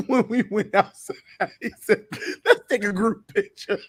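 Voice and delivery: high, laughing retelling. Real, not acted. Friend retelling the awkward group picture, laughing. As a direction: tell it through laughter.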